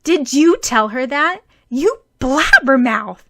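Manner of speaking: loud accusing yell; a furious girl scolding a gossiping boy; angry demand. A narrator's reading. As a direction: snap at the blabbermouth. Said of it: "Mei Lin demanded".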